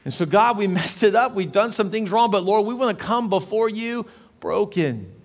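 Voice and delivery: high, forceful, driving exhortation; impassioned preaching. Real, not acted. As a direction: preach at full force, pleading for the nation.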